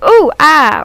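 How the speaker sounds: a quick pained yelp, mildly acted out; retold yelp. Yelping at the dog's claw on her forehead, mock pained. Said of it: "I probably yelped or something".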